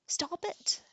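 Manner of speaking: high, loud scream. A narrator's reading. Girl screaming at the prince to stop, loud scream. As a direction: scream it to make him stop. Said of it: "Arya screamed".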